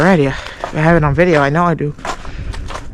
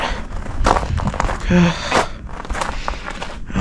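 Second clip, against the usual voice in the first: heavy sigh; 7 dB quieter than usual. Real, not acted. Tired after pushing tractor.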